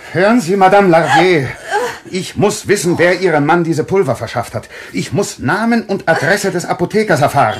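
loud, urgent interrogation; forceful demand. Acted. Doctor shocking the widow out of her daze, loud and urgent. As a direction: press her hard for the name.

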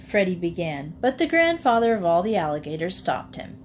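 ordinary delivery

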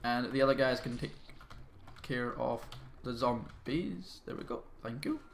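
ordinary delivery

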